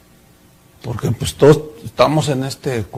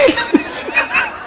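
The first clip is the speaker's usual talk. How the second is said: high laugh; 5 dB quieter than usual. Real, not acted. Laugh after story.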